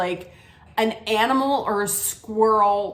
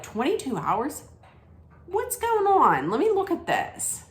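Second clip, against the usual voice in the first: surprised; 7 semitones higher than usual. Retold or imitated. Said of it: "And I was like".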